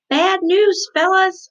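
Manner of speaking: high, loud holler. A narrator's reading. Girl calling to her parents from the living room, hollering loud. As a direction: yell it across the house. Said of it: "I hollered very loud".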